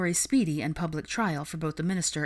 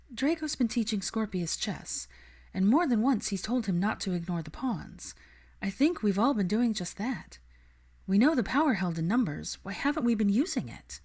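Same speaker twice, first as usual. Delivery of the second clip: higher, earnest and idealistic; higher voice, passionate young woman; Hermione's voice; 5 semitones higher than usual. Acted. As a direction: plead the cause with conviction. Hermione pitches her idea.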